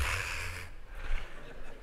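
long, breathy, defeated sigh; weary sigh. Retold or imitated. Driver caught by the cop, a weary sigh. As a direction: let the air out like you've given up.

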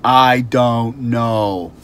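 loud, very slow, drawn-out exasperation; exasperated. Shown as a demonstration. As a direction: stretch each word out fed up. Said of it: "respond back to them with annoyance".

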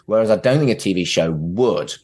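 usual speaking voice

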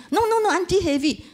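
eager helper pressing his point; foreign worker insisting. Retold or imitated. Foreign worker insists the auntie's bags are heavy. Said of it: "and he said".

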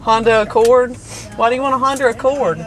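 ordinary talk, nothing marked

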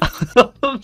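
short high laugh bursting out; high burst of laughter. Real, not acted. At the friend stuck in the game, a high laugh.

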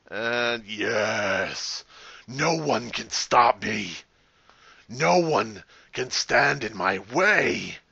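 slow, drawn-out mock-evil voice; gloating villain. Serial killer player gloating over the game, slow villain voice. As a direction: stretch each word like a triumphant villain.

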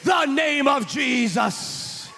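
high, loud, slow preaching cry; preaching at full force. Acted. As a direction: proclaim it over the music. Preaching climax.

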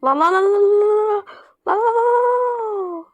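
rising cries as the truck slides, stretching out at the end; panicked repetition. Real, not acted. Driver watching his truck crash, panicking.

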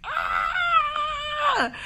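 high delighted shriek; excited squeal. Retold or imitated. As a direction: squeal at the baby shop. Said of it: "I was like".

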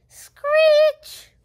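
shrill high loud bird screech. Acted. Girl acting the owl in the story, loud screech.

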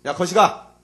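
put-on unloving shout at the kids; rough shout. Shown as a demonstration. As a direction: bark it carelessly. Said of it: "뭐 이렇게 부를 수도 있겠고".

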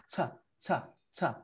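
"Ta, ta, ta" as separate short bursts; isolated T demo. Shown as a demonstration. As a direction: show the lone t sound in "Ta". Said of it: "la T solita tiene este sonido".

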